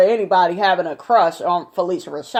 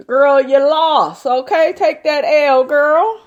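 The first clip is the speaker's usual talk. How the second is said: sassy taunt; 5 semitones higher, 4 dB louder than usual. Real, not acted. Taunting Mariah.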